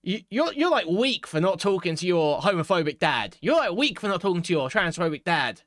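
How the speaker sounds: high, lecturing mock voice, fast; mocking quote. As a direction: sneer it like a preachy know-it-all. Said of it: "and being like".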